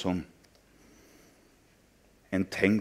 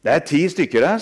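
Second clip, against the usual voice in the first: put-on gleeful boy; 6 semitones higher, 7 dB louder than usual. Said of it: "sa han".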